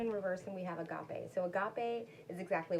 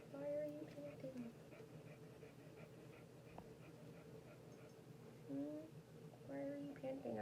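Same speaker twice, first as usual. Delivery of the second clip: higher and softer, cooing to the dog; soft pet talk; 6 semitones higher, 12 dB quieter than usual. Real, not acted. To her panting dog, a high soft coo. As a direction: gently ask the pup what's wrong.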